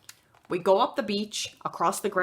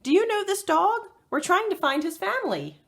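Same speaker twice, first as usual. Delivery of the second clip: calling out; 12 semitones higher than usual. Acted. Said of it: "Kayla shouts to a fisherman".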